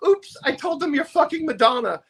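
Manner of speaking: high, breezy flamboyant promo voice; campy promoter. Retold or imitated. Promo man admitting the [name] lie, breezy and high. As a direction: toss off the lie lightly in a high camp voice.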